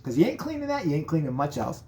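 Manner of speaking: ordinary talk, nothing marked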